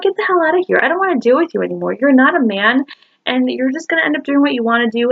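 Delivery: high-pitched, fed-up telling-off; retold angry outburst. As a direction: replay throwing him out, pitch jumping up. Retold breakup fight, throwing him out.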